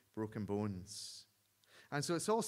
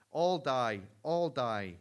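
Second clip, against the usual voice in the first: loud shrieking guard, much louder; shrieked; 2 semitones higher, 8 dB louder, slower than usual. A narrator's reading. Japanese guard threatening the prisoners with a loud shriek. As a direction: scream the threat at the line of prisoners. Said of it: "he shrieked".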